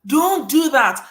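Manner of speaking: mock warning. Telling the sender off, slow mock warning. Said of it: "You almost want to say".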